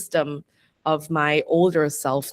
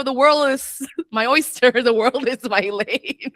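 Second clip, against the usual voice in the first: laughing bravado; 7 semitones higher than usual. Retold or imitated. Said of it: "I'm like".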